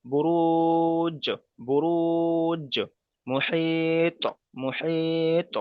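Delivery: বুরুজ and মুহিত stretched very slowly, last letter bounced; qalqala examples. Shown as a demonstration. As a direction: drag out বুরুজ and মুহিত, echo the end. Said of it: "যেমন".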